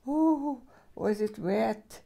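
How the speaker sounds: usual speaking voice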